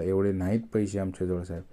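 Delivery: ordinary delivery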